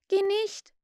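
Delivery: high pleading call; pitying. A narrator's reading. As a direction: plead with full sympathy. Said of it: "riefen die anderen voller Mitleid".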